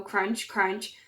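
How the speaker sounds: usual speaking voice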